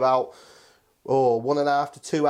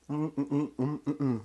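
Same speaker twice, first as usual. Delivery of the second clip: savouring hums; 7 dB quieter than usual. Real, not acted. Cook tasting his dish, soft happy hums. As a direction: hum with pleasure at each bite.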